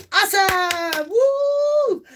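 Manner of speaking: high, loud, slow delight; excited cheer. Real, not acted. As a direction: cheer the viewers in. Welcoming viewers on live.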